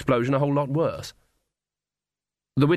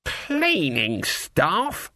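very slow, higher, incredulous question; affronted echo; 5 semitones higher, much slower than usual. Acted. Granny throwing the captain's words back, slowly affronted. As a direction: draw out each word in disbelief.

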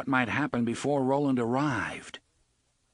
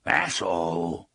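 low, slow snarled animal word; through bared teeth; 5 semitones lower than usual. Acted. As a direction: growl it like a small beast. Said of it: "through his bared teeth".

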